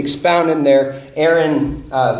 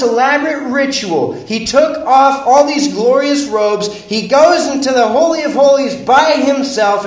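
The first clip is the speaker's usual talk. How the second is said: louder and higher, driving each line home; preaching at full force; 9 semitones higher, 6 dB louder than usual. Acted. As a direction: preach it like the climax of the sermon. Sermon builds to full force.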